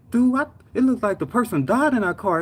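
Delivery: high, amazed exclamation; retold shock. Coworker who saw the wreck, shocked and high-pitched. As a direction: marvel at how bad it looked.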